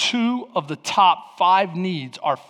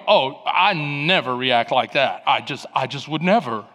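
sarcastic; 6 semitones lower than usual. Acted. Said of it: "one of those sarcastic ones".

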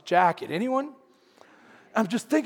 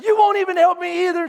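high, loud accusation; hammed-up frantic complaint; freaking out; 12 semitones higher, 8 dB louder than usual. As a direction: snap it loudly in a mock panic. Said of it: "freaking out".

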